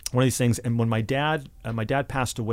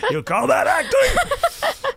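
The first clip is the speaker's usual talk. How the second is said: a teasing father's jab, laughing high through the words; slow, laughing mock of a proud dad ribbing his son; 6 dB louder, much slower than usual. Dad teasing his actor son, laughing mock scorn.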